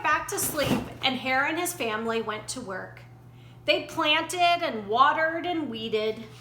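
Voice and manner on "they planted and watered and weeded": usual speaking voice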